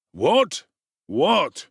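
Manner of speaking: loud, high sudden alarm; startled beadle. Acted. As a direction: cut in, startled. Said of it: "interposed Mister Bumble".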